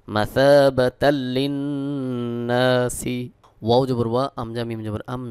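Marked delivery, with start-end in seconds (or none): 0.1-3.2 "মাসাবাতাল লিন্নাসি" chanted slowly, tanween run into the lam; merged lam with ghunna. Shown as a demonstration. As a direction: join "মাসাবাতাল লিন্নাসি" at the l and hold the doubled n. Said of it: "লামকে যুক্ত করতে হবে".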